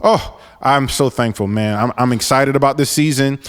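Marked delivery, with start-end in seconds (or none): none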